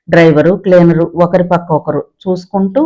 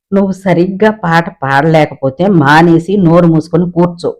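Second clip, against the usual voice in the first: usual speaking voice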